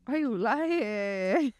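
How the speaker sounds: long, dragged-out words, needling a friend; drawn-out teasing accusation. Real, not acted. Teasing a friend who denies crying at movies, drawn-out accusation. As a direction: stretch it out slowly, teasing.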